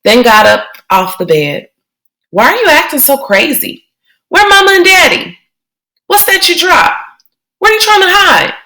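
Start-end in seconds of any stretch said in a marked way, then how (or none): 2.3-8.5 put-on higher, pleading kid-sister voice; a worried young girl questioning her brother; child's voice, rising alarm; little sister Joey.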